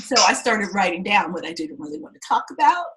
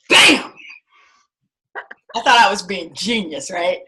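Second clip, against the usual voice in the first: ordinary delivery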